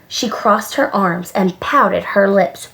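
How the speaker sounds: ordinary delivery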